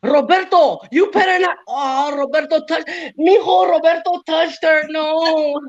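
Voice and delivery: high, slow mock grandma yelling; high mock grandma shouting at her telenovela. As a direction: squawk at the screen like an upset grandma. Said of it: "your grandma at home be yelling at the fucking T V".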